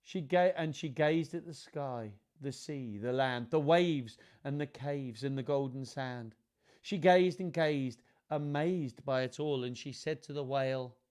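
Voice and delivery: measured, lilting picture-book reading; children's story narration. A narrator's reading. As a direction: read it to children, savouring the rhymes. Reads picture book aloud.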